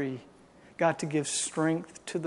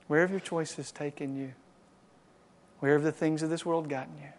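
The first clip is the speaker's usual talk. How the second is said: ordinary talk, nothing marked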